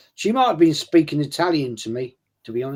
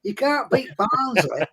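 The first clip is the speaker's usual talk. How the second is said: 1 mock Yorkshire; 9 semitones higher than usual. Yorkshire catchphrase put on.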